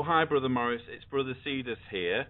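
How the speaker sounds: normal talking voice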